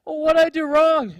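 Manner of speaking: high, loud whiny lady voice; whiny old lady. Retold or imitated. Lady complaining to her surf instructor, high whiny voice. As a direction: whine through a mouth of sand. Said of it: "spitting out sand".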